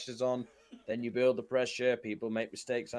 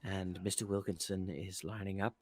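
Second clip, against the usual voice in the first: snooker commentator; 6 semitones lower, 7 dB quieter than usual. Retold or imitated. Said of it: "Can you imagine that just".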